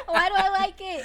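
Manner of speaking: laughing. Real, not acted. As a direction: giggle the question out helplessly. Laughing at Batman voice.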